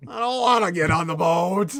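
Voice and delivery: loud whiny refusal; whiny reluctant voice. Acted. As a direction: whine it like a stubborn child.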